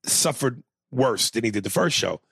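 normal talking voice

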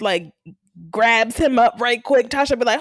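ordinary delivery

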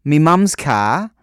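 loud, hammed-up Aussie; Australian accent attempt. Shown as a demonstration. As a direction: punch it out in mock Australian. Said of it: "an equation for Australian accent".

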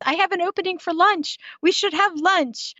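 high, bright-eyed invitation; perky. Retold or imitated. Sister calling with a lunch offer, perky and high. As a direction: sound chirpy and eager. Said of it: "she's all perky".

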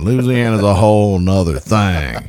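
slow, low mock Texas drawl; hammed-up slow Southern accent; Texas-Louisiana twang. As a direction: stretch it out like a put-on Texan. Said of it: "you're seeping into Louisiana".

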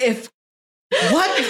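incredulous laugh at the show; laughing disbelief. Retold or imitated. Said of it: "I was like".

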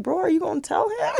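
high-pitched, incredulous mock urging; urgent prompting. Mom prompts her son during a muted call, urgent prompting.

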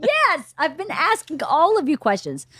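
high, strained exasperation; exasperated answer. Retold or imitated. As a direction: answer the kids at the end of your rope. Retold mother after her scream.

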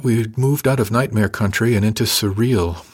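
usual speaking voice

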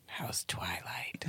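slow, very soft EVP whisper; faint imitated ghost voice; ghostly whisper. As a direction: whisper slowly, mimicking a ghost. Guessing what ghost whispered.

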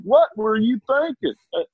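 high, scolding Doctor Phil voice; Doctor Phil impression. Doctor Phil scolding Jerry Jones about the sideline. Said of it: "easy one to always do for football season".

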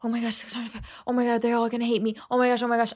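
hushed, hurried panicky muttering; quick, under-the-breath dread; retold freaking out. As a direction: mutter it to yourself in mock panic. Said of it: "I'm freaking out".

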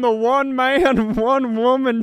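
high, loud barker voice; hammed-up announcer. Co-host plays a ride barker calling riders, high and loud. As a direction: bark it like a carnival host.

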